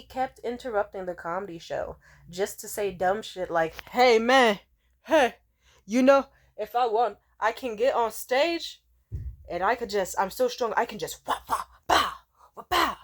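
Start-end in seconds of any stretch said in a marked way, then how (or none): 3.9-13.0 put-on loud, higher drunk-guy boasting; mock a drunk loudmouth, punching out the sound effects; drunk heckler. Drunk man interrupting a comedy show, loud mock boasting. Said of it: "this dude drank way too much".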